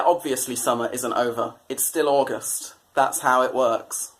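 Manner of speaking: ordinary delivery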